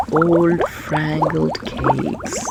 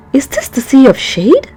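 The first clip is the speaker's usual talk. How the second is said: in dismay; 6 semitones higher, 6 dB louder than usual. Acted. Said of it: "Peso asked in dismay".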